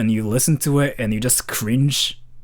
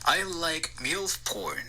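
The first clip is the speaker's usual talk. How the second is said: played-back recording; 4 semitones higher, 10 dB quieter, much slower than usual. Shown as a demonstration. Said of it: "save it and play it".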